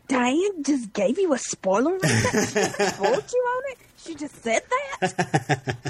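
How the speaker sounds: high-pitched, disbelieving questions; hammed-up "can you believe it" outrage; mock incredulity. Acts out others' shock at spoiler.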